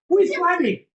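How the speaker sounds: high, loud excited question. Real, not acted. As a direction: make the picture sound thrilling. Excited picture-book quiz for child.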